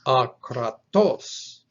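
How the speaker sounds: akratos with a loud, punched final TOS; slow a-kra-TOS, landing hard on the end of akratos; stress on last syllable. Shown as a demonstration. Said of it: "with the emphasis on the last syllable".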